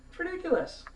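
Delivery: high-pitched disbelief; incredulous high squeal of protest; exasperated. Real, not acted. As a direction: let the voice leap up in exasperation. Ranting about the false names.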